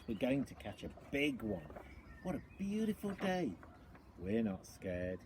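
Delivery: ordinary delivery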